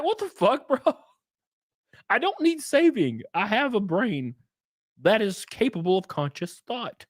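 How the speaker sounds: high, offended push-back, lightly voiced; imitating someone insulted; mock indignant reply. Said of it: "wouldn't you be like".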